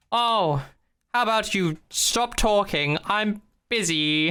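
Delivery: hammed-up British accent, airily busy; mock posh British. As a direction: brush them off as a posh Brit. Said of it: "in a posh British accent".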